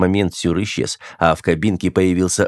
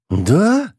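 high rising surprised question; 10 semitones higher than usual. A narrator's reading. Officer hears who the engineer really is, surprised. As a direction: ask it in high-pitched surprise. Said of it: "удивился Сюр".

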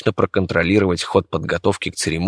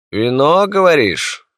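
smiling; 8 semitones higher, 4 dB louder, slower than usual. Acted. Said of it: "улыбаясь, произнёс император".

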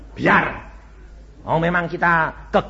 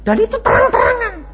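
loud, high testimony cry; preaching at full force; 11 dB louder than usual. Acted. Preacher proclaiming his own practice to the congregation, full force.

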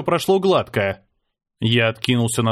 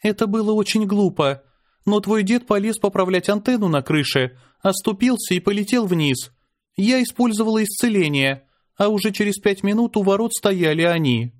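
put-on light, higher girl's voice; young girl voice; 7 semitones higher than usual. A narrator's reading.

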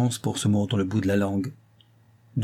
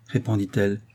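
plain reading voice

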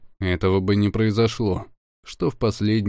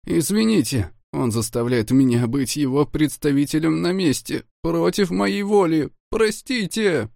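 high, tearful pleading apology; sobbing apology; 7 semitones higher than usual. Acted. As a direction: beg forgiveness through sobs. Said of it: "горько рыдая".

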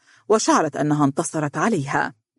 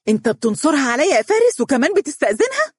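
high, quick outraged protest; indignant cry; 10 semitones higher, faster than usual. A narrator's reading. Fiancée accusing Faris of siding with the girl, indignant. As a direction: protest in a shrieking hurry. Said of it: "صاحت دنيا".

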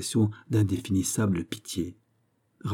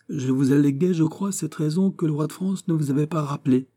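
higher-pitched royal voice; courteous questioning king; 8 semitones higher than usual. Acted. King reminding the viscount of his refusal, courteously probing. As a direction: recall a past argument in a raised, kingly register.

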